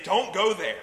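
loud, high impassioned plea; begging, pleading. Acted. Holy Spirit begging a believer not to go there, loud desperate pleading. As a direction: cry out and plead at full preaching force. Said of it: "Holy Spirit will be begging and crying".

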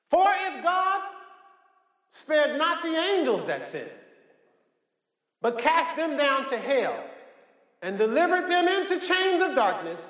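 very high, drawn-out declamation; preached scripture at full force. Acted. Preacher reading of judgment to the congregation, high-pitched proclamation. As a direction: proclaim it high and slow, phrase by phrase.

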